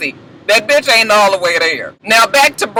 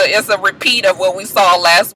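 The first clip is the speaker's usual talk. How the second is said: ordinary talk, nothing marked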